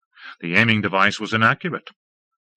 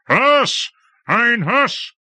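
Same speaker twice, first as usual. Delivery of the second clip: high shout across the court; calling out; 14 semitones higher, 4 dB louder, much slower than usual. A narrator's reading. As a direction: summon him loudly. Calling out for the jinxman.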